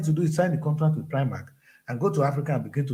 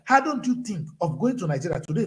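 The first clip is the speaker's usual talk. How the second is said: ordinary talk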